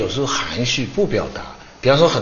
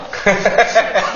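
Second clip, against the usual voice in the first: burst of laughter; 5 semitones higher than usual. Retold or imitated. Retelling her win to a Chinese audience, laughing. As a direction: laugh out mid-story, higher. Swimmer's story told in her voice.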